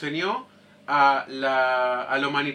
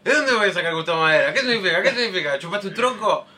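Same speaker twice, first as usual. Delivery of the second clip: mocking friend; 5 semitones higher, 7 dB louder than usual. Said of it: "me decía".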